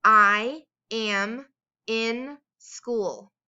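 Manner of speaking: very slow, every word drawn out; slow dictation. Shown as a demonstration. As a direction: dictate slowly for young writers. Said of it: "Here is the sentence you're going to write".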